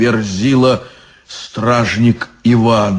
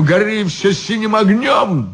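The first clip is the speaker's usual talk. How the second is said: put-on drunken shout; 8 semitones higher than usual.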